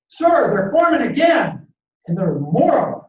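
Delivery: high, loud, urgent call down from a tree; lookout's warning shout. Retold or imitated. Boy lookout in the tree warns the colonel, shouting loud. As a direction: yell the warning down to the officers.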